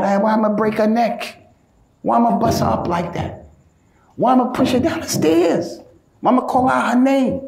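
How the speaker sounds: high-pitched, incredulous rhetorical questions; acted protest, pitched well up; mock indignation. Mock indignation about a hypothetical husband's reaction.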